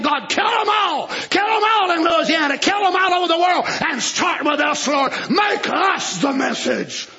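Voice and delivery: mock fervent prayer.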